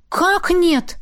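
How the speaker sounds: high, stunned "how not?"; a sharp, disbelieving cry; a hint of chorus-like shock; shocked exclamation. A narrator's reading. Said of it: "хором воскликнули".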